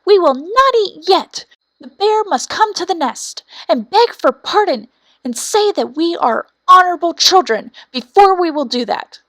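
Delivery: high, loud stubborn chicks; defiant young birds. A narrator's reading. Young wrens refuse food from their parents, high and defiant.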